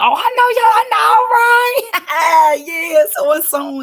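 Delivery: high shouted laughing recognition; laughing out loud. Retold or imitated. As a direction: burst out laughing as you greet old friends. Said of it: "they busting up".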